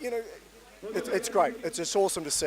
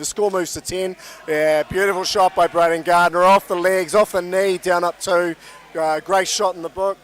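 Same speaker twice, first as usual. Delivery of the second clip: loud, raised commentary voice; live play-by-play; 4 semitones higher, 9 dB louder than usual. Real, not acted. Commentator calls Gardner's shot for viewers, excited loud play-by-play. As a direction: call the shot loudly as it happens.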